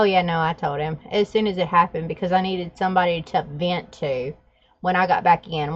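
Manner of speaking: ordinary delivery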